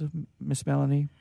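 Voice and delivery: usual speaking voice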